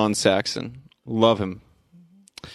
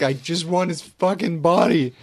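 higher-pitched, exasperated imitation; worked-up mock outburst; 7 semitones higher than usual. Retelling a film character losing her mind, mock exasperated. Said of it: "she's just losing her fucking mind".

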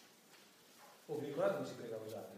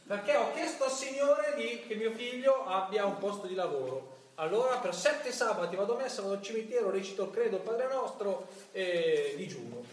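louder and higher, listing devotions; mock pious bargaining; 5 semitones higher, 7 dB louder than usual. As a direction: ham up a mother bargaining with God. Mocking devotee bargaining for son's job.

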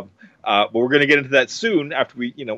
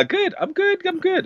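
high playful voice. Acted.

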